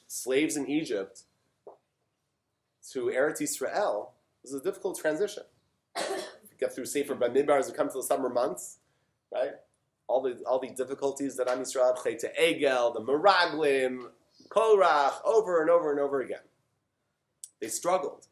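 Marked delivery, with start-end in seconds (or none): none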